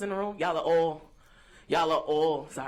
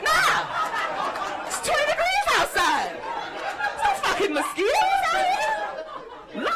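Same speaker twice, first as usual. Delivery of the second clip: high-pitched outraged comeback; indignant disbelief; slower than usual. Acted. Bitten guest rejecting the mosquito excuse, high indignant protest.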